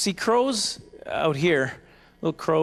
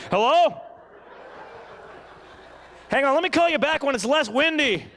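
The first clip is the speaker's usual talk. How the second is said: shouting into a phone; 9 semitones higher than usual. Pretend. Tiny-headed man on giant iPad.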